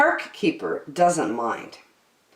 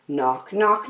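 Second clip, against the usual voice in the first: ordinary delivery, nothing marked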